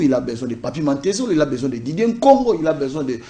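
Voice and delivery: ordinary talk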